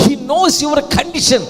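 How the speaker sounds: sudden high preaching shout; impassioned proclamation. Real, not acted. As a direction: cry out the assurance with full force.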